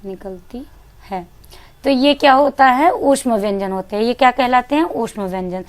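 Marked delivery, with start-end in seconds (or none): 0.0-1.2 निकलती breathed out very softly, almost voiceless; near-whispered निकलती; breathy near-whisper. Shown as a demonstration. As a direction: breathe निकलती out like warm air. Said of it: "ऐसा हमें प्रतीत होता है".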